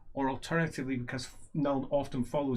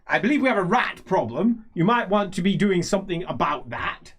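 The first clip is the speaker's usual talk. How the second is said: loud, higher, pointed complaint; raised voice, lordly reproach; imperious old nobleman; 5 semitones higher, 7 dB louder than usual. Acted. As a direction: hand down a pointed order to staff. Same character orders servant.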